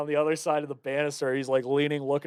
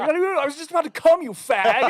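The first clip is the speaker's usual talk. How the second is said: very high, loud shrieking dad voice breaking into laughter; hammed-up frantic father, laughing through it; Robin Williams panicking; 13 semitones higher, 7 dB louder than usual. Quoting the movie's opening line, high shrieky voice. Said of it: "He's like".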